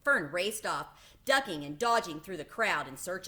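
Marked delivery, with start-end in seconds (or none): none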